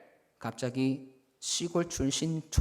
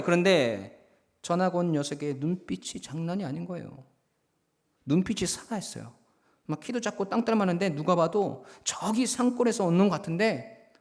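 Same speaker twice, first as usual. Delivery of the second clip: ordinary talk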